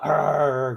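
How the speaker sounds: loud, rolling mock snarl; imitated growl. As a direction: growl it out like a wild animal. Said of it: "growling, snarling".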